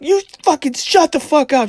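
high, loud furious mom yell; angry mom. Retold or imitated. Mom snapping at her smartass son, loud and high. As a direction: bite his head off, high and loud.

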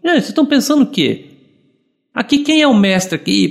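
high, fast mock rebuke, a bossy master; hammed-up indignant boss; mock scolding. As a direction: play a haughty teacher putting them in their place. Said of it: "ele poderia chegar e falar assim".

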